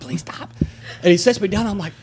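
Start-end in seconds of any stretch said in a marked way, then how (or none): 0.0-0.5 high, weak strangled plea; softer mock choking; imitated choked plea. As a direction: squeeze out a small voiceless plea. Retold plea while being choked.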